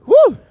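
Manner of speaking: a loud, very high whoop of delight; joyful whoop. Real, not acted. Preacher whoops to the congregation, a loud joyful cheer. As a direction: let out a real cheer of joy.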